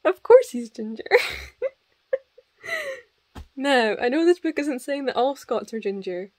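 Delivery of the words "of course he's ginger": amused exasperation, breaking into laughter; cracking up. Real, not acted. Reader reacting to the prince being ginger, cracking up. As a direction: laugh your way into a louder repeat. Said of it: "It's cracking me up".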